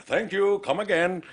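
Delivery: put-on high-pitched caricature Indian accent; mock Indian accent. Host shows guest a Hank Azaria style voice, mock Indian accent. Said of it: "Like, that voice sounds Indian".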